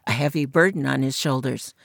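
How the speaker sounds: ordinary delivery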